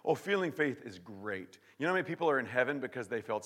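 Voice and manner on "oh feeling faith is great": ordinary talk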